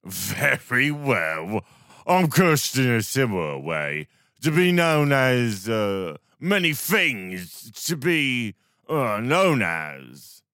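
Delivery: slow, loud slurred rambling; town drunk. Acted. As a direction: drag it out drunkenly.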